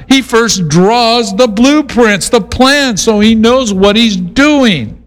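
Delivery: preaching. Acted. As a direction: drive the point home from the pulpit. Preaching climax on the fool.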